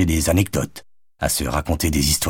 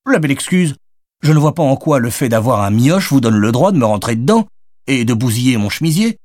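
high, raised sarcastic scolding; bitter complaint; 6 semitones higher, 5 dB louder than usual. A narrator's reading. Madeleine blaming Jonathan for her stained blouse, high and sarcastic. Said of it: "déplora-t-elle".

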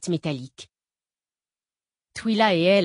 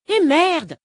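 loud, high exclamation of annoyance; crying out; 9 semitones higher, 7 dB louder than usual. A narrator's reading. Jolie exclaims at her sister's secrecy, loud outburst. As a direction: blurt it out loud in frustration. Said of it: "s'écria Jolie".

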